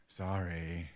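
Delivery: slow, subdued imitated apology; made-to-mean-it apology. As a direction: stretch the sorry out obediently, imitating a kid. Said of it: "say it like you mean it".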